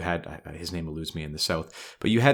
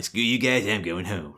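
Cartman impression; 3 semitones higher than usual. Arnold quitting on Washington, done as a bratty Cartman. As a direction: do a quick Cartman from South Park. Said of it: "like Cartman".

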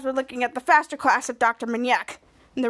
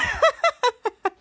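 high, rippling put-on laughter; put-on host laugh; 10 semitones higher than usual. Mary laughs after naming her show, high host laugh.

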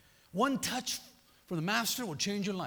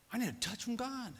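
usual speaking voice

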